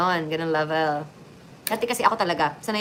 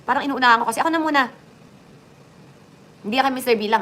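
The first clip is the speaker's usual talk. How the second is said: ordinary talk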